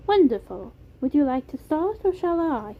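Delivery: put-on confident British accent, high and smiling; Beam, British accent. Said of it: "smiling more confidently now".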